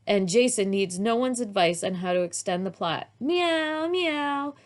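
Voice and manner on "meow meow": child playing a kitten; kitty meow. A narrator's reading. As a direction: mew like a small cat. Girl pretending to be kitty.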